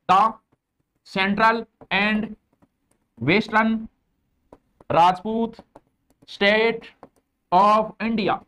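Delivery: each word set apart with long pauses, slow; slow word-by-word dictation. Shown as a demonstration. Teacher dictating to students, word by word, slowly. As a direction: spell it out word by word so students can copy. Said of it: "ऐसे English बोलने में स्वाद आता है".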